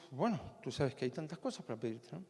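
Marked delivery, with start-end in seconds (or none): none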